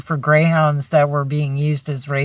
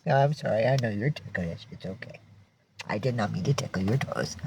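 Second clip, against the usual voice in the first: soft soothing; 7 semitones lower, 10 dB quieter, faster than usual. Real, not acted. Soothing the ticklish dog.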